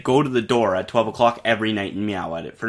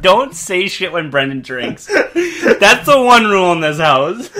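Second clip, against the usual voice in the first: slow, higher, louder laughing tease; 8 semitones higher, 7 dB louder, slower than usual. Real, not acted. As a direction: tease him through laughter, slowly. Teasing friend who choked laughing.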